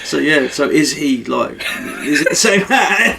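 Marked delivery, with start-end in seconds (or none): 2.0-3.2 slow question rising into laughter; higher, drawn-out, laugh-filled question; real laughing. Real, not acted. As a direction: start cracking up as you ask. Breaking into laughter while asking.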